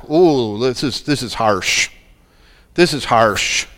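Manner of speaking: ordinary talk, nothing marked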